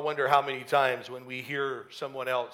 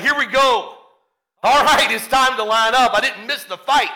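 loud, higher, raring to fight; excited battle shout; 6 semitones higher, 7 dB louder than usual. Acted. David joining the battle line, excited shout. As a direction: shout it like you're rallying for a fight. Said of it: "he shouts for the battle".

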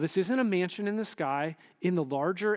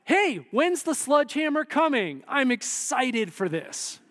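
eager Judas; 8 semitones higher, 5 dB louder than usual. Retold or imitated.

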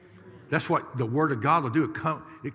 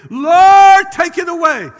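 high, very loud wailing plea imitated; yelling out; 19 dB louder, slower than usual. Woman at the altar begging the Lord, imitated loud yelling. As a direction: mimic a woman hollering to heaven. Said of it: "then she'd pipe up again".

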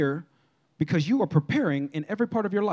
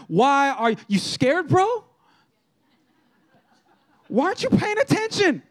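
much higher, laid-back buddy tone; casual Jesus voice; 7 semitones higher than usual. Retold or imitated. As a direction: ask it like a casual friend, pitched up high. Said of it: "Then he asked them".

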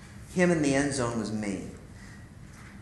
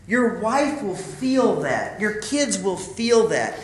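slow, higher, building sermon cadence; preaching; 7 semitones higher, 4 dB louder, slower than usual. Real, not acted. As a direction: build each line like a preacher.

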